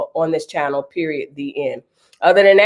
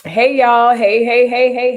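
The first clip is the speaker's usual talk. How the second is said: bouncy, louder hellos; sing-song greeting; 5 semitones higher, 4 dB louder, slower than usual. Real, not acted. Host welcoming her live chat, sing-song and loud. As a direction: greet the room brightly. Greeting viewers as they arrive.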